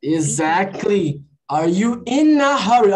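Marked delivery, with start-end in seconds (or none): 1.5-2.9 "in a" joined as "inna", slow and loud; linked words. Shown as a demonstration. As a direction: slowly model "in a" run together. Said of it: "viu como você juntou?". ("in a" emendado, bem devagar)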